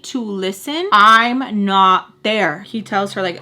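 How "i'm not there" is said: loud, drawn-out insistence; retold yell. Woman yells at him that she is not there, retold yell. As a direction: stretch each word out, loud. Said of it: "She yells at him again".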